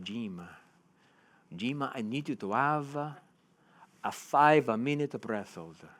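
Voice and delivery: put-on slow Italian accent; measured Italian-accented instruction, drawn out. Freediving tutor answering his pupil on the phone, Italian accent. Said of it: "in his beautiful Italian accent".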